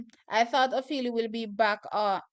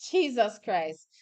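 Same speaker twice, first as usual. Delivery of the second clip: ordinary delivery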